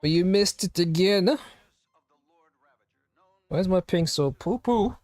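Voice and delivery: ordinary delivery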